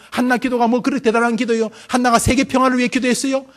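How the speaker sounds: ordinary delivery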